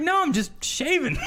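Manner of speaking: high, drawn-out innocent denial; retold protest. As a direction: protest your innocence, hammed up. Retold protest to partner's accusation.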